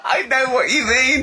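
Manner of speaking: high, slowed words pushed out through laughter; cracking up while agreeing; laughing hard. Real, not acted. To Carl about the wig, laughing hard while agreeing.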